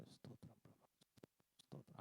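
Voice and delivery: mock whispered prayer, barely a breath; inaudible whisper. Imitating believers who pray too softly to hear, whispered. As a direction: whisper the praise so no one hears. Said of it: "ఏం వినబడదు మనకి".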